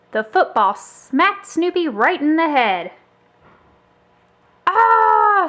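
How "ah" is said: high, loud yelp of Ah; sharp storybook ouch; cry of pain. A narrator's reading. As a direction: hit by a surprise blow. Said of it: "Snoopy cried".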